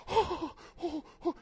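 high, soft frightened gasps; scared. Pretend. Disciples seeing a ghost, high and frightened. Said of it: "You're like".

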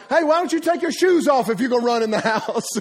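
high-pitched call breaking into laughter; mock-helpful uncle. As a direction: call out mischievous advice to the kids, laughing. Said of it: "And I'm like".

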